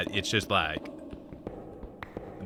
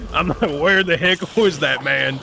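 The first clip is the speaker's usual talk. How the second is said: laughing; 8 semitones higher, 9 dB louder than usual. Real, not acted. Laughing through in-game surprise.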